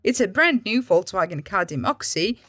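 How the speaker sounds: ordinary delivery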